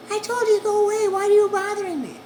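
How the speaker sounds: high, loud woman shooing him off; annoyed woman behind the door. Retold or imitated. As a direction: shout go away through the door. Woman irritated at repeat knocking.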